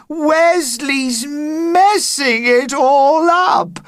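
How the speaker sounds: high, loud, slow mock wail; girl wailing about the spoiled play, hammed-up wail. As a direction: wail it like a ruined schoolgirl. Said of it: "wailed Carly".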